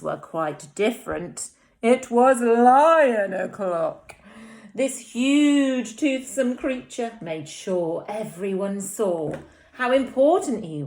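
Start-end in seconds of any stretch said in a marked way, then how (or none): none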